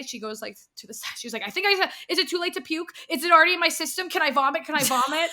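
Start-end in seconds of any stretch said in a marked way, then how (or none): 1.4-5.3 higher, louder rush of questions; retold panicked questioning. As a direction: imitate a friend frantically asking. Retold friend panicking about the edible.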